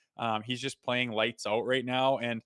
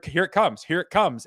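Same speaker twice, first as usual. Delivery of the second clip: louder, higher, building hype; excited anticipation; 4 semitones higher, 7 dB louder than usual. Retold or imitated. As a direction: retell a fan expecting a goal. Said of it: "it's like".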